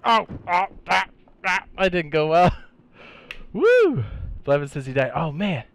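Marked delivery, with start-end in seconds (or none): 0.0-2.5 high loud startled cry; startled shout. Real, not acted. Just got shot, startled. 3.5-4.0 very high loud whoop; excited whoop. Real, not acted. Reacting after the fight.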